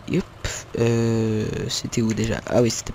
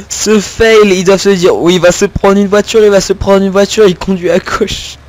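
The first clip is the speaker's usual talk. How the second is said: laughing mockery; 4 semitones higher, 7 dB louder than usual. Real, not acted. Mocking a driver in game.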